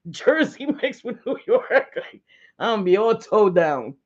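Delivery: talking through laughter; words breaking up in real giggles; laughing. Real, not acted. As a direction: crack up at yourself while saying it. Laughing at own mixed accent.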